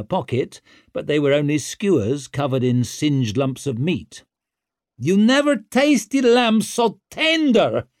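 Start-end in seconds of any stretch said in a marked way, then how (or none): 5.0-7.9 high, loud, slow hawker's roar; roared sales pitch. A narrator's reading. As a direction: bellow it right in the customer's face. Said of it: "he roared in Tallow's face".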